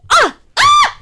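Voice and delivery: high, loud shriek of disgust; horrified scream. Real, not acted. Podcaster recalling the scene, screaming in real disgust. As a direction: shriek like you can't bear to watch.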